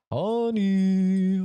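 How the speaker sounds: loud sing-song call. Acted. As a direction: call to her across the house. Calling out to wife.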